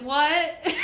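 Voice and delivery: loud, high-pitched incredulous cry; exaggerated, laughing bafflement; mock disbelief. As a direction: imitate someone utterly baffled. Acting out people's baffled reaction.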